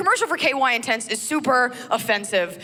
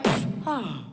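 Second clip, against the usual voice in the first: pained wince; 5 semitones lower, 4 dB quieter than usual. Retold or imitated. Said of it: "been like".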